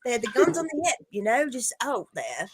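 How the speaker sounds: high-pitched Australian attempt that lands British; botched Australian accent. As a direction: imitate an Australian and slip into British. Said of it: "That was more British".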